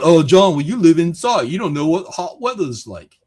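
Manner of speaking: a mild British sneer at John; half-hearted British accent mock. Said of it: "I don't know why I made that British".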